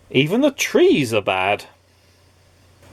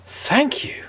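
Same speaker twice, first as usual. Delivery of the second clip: awed whispered thanks; 13 semitones higher than usual. A narrator's reading. Mister Good thanking the woman in a whisper. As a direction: breathe it out, overwhelmed. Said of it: "said Mister Good".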